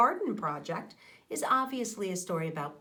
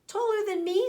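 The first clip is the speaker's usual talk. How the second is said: put-on much higher and louder, wide-eyed kid; amazed child voice; 11 semitones higher, 5 dB louder than usual. Child Hannah marveling at sunflowers, high excited exclaim.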